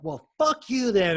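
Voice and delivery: loud, high, drawn-out retort; retold angry outburst. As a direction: act out lashing out at the girlfriend. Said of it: "I got really mad and everything".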